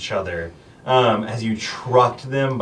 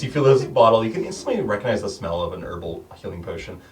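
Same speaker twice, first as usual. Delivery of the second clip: ordinary talk, nothing marked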